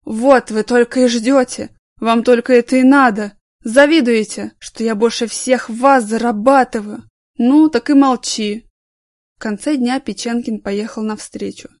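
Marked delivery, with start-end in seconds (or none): 0.1-8.7 loud bitter yelling; drunken shouting. Acted. Drunk employee yelling at coworkers, loud and bitter. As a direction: hurl the accusations loud.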